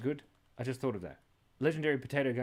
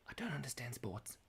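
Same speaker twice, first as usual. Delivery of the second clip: whisper; 9 dB quieter than usual. Real, not acted. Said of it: "whispered aside".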